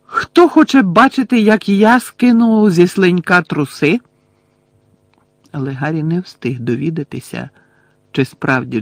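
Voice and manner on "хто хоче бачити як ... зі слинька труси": high, loud showman's taunt; gloating call to the crowd. A narrator's reading. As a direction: rouse the crowd cruelly. James showing off to crowd.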